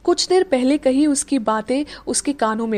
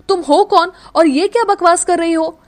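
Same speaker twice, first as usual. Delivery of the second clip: loud, higher angry demand; furious at the stranger holding him; 6 semitones higher, 6 dB louder than usual. Acted. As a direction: snap the question at her. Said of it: "लड़के ने गुस्से से कहा".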